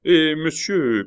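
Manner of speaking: loud, high hail; calling up. Acted. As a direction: call up urgently to someone above. Innkeeper hails man on balcony.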